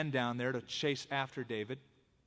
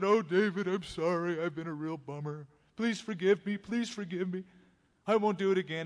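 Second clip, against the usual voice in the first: higher, louder, mock-tearful pleading; a touch of acted crying, begging forgiveness; crying apology; 4 semitones higher, 4 dB louder than usual. Saul tearfully begs David's forgiveness, mildly sobbing. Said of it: "And he started crying".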